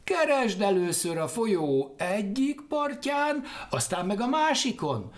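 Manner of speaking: lightly voiced high crows; jackdaw voices. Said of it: "Mire a csókák így feleltek". (enyhén magas csókahang)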